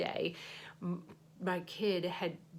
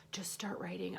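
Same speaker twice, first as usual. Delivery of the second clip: whisper; 3 dB quieter than usual. Real, not acted. To herself, urging herself to write, whispered. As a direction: repeat it in a soft unvoiced whisper.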